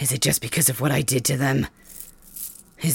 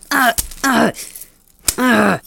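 sharp, higher grunts of pain; 7 semitones higher, 4 dB louder, slower than usual. Acted. Prisoner being whipped, pained grunts. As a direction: cry out under the lash.

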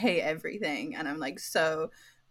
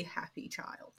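hushed aside; whispered; 2 semitones lower, 13 dB quieter, slower than usual. Real, not acted. Report card quote whispered.

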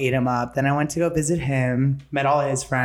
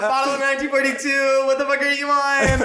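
mocking voice; 9 semitones higher than usual. Mocking the hater's line.

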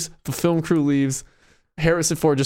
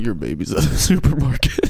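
low creepy voice breaking into laughter; creepy David Blaine; 6 semitones lower than usual. Acted. David Blaine telling about the vanished baby, low, laughing. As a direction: crack up inside the low magician voice. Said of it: "Yeah, in his creepy voice".

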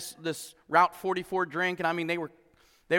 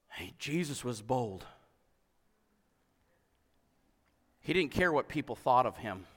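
softer and lower, down to a whisper; fading to a whisper; 8 semitones lower, 8 dB quieter than usual. Real, not acted. Pastor to his church about Jesus's mercy, fading to a whisper. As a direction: let it sink to a hush. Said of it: "sermon dropping to hush".

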